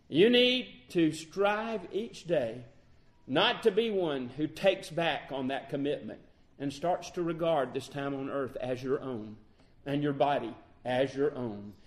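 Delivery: ordinary talk, nothing marked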